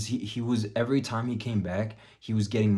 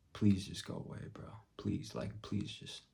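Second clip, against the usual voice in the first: barely voiced, weary inner plea; hushed plea; 9 dB quieter than usual. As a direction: beg him under your breath, very softly. Retells silent plea to pest.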